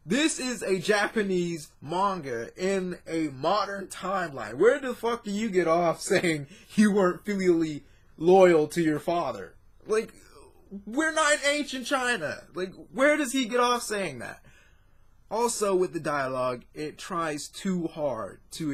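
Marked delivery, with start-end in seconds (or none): 4.5-14.4 incredulous rant. Real, not acted. Ranting at manga grandfather's line.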